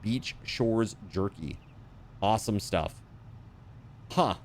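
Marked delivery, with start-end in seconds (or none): none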